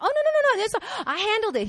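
high-pitched flustered reassurance; hasty protest, acted. Wife telling her husband not to come, high and flustered. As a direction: rush to head him off in a high voice.

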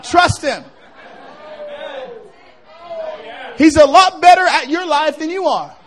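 loud rising sermon cry; preaching at full force. Acted. Pastor rallying the sick to face down the devil, loud. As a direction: drive it home to the crowd.

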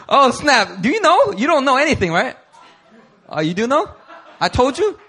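excited teasing. Real, not acted. Teasing someone who walked in.

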